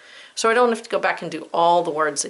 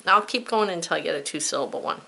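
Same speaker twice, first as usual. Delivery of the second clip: normal talking voice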